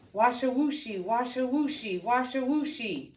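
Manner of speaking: hushed slithering sound. A narrator's reading. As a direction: whisper the snake's slide like a rhythm. Python slithering sound effect.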